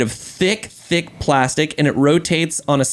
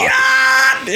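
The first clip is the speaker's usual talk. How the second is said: short, higher mock shout; mock morning yell; 7 semitones higher than usual. Dad imitating his son waking up, mock yell. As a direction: imitate a little boy yelling.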